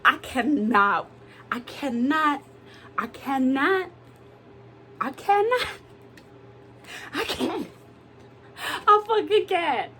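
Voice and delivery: mock exasperation rising into a laughing wail; overwhelmed. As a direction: build to breaking point, half laughing and half crying. Client voiced overwhelmed by arguing family.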